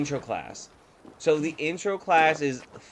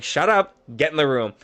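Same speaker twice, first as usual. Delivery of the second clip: retold sharp order; 2 semitones higher, 6 dB louder than usual. Offended teacher silences kid, retold loud sharp order. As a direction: act out cutting a kid off.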